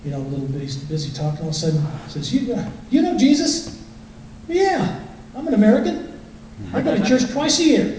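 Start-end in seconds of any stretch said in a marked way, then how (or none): 2.3-3.8 higher, earnest pastor voice; pastor's probing question. Retold or imitated. Pastor asking [name] about Jesus, higher and earnest. 4.5-8.0 high, breezy smug reply; smug past self. Retold or imitated. [name] smugly answering the pastor, high and breezy.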